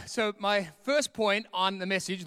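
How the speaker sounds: usual speaking voice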